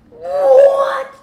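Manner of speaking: high, drawn-out horrified scream; losing her mind. Retold or imitated. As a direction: scream it out in shock, very loud. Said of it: "I'm losing my mind".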